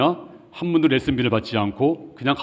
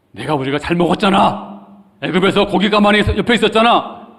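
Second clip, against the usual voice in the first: high, louder longing complaint; nostalgic whining; 8 semitones higher, 5 dB louder than usual. Retold or imitated. Israelites longing for Egypt's meat, high and loud whining. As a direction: whine for the old meat pots.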